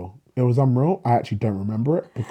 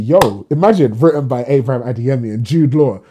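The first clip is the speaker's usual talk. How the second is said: excited inner thought; 3 semitones higher, 8 dB louder than usual. Retold or imitated. Imagining a credit shared with Jude Law, excited. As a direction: let the dream credit ring out loudly.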